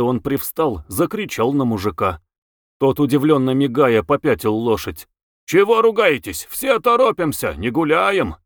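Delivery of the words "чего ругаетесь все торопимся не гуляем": higher-pitched protest, raised voice; indignant retort. A narrator's reading. Young coachman to the men scolding him, indignant. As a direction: answer the scolding back. Lad answers back in the quarrel.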